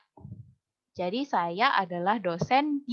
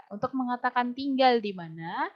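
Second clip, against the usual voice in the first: normal talking voice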